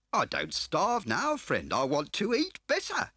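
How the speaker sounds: smarmy dealer playing grand; oily fat car dealer. A narrator's reading. Scar's voice held.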